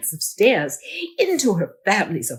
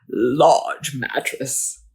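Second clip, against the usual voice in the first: ordinary delivery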